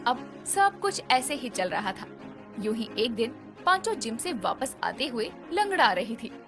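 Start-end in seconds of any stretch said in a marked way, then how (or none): none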